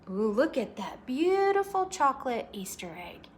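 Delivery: usual speaking voice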